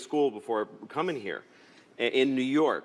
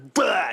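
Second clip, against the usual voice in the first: imitated vomiting; 4 semitones higher, 6 dB louder than usual. Dad imitating his daughter throwing up in the car, mock retch. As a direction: burst out a drawn-out retch. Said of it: "just vomit".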